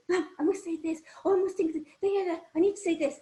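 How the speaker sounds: itching to butt in with a point; impatient listener. Retold or imitated. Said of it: "you're waiting to butt in".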